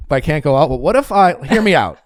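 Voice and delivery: insistent little negotiator; negotiating child. Retold or imitated. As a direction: wheedle like a kid making a case. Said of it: "I had to figure out the right way to ask, or I would negotiate".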